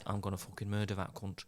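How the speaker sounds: under the breath, menacing; quiet menace. Acted. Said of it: "talking quietly from a distance".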